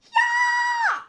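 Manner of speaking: very high, piercing loud yell; high-pitched shriek of panic; scream. Acted. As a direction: let out a shrill scream. Screaming in the flames.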